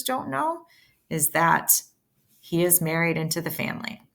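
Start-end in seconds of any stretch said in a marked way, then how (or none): none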